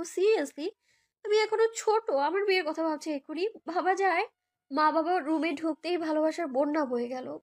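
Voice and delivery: usual speaking voice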